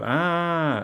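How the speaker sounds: high, loud drawn-out "ah"; startled scream. Acted. Diner seeing a mouth in the sandwich, startled scream. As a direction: cry out in alarm, long and high.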